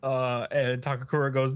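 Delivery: plain reading voice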